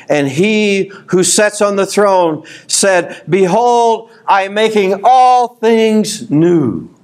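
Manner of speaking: high, slow, ringing proclamation; preacher reading the promise aloud to his church; scripture reading. Acted. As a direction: announce it with awe. Reads scripture, raised and slow.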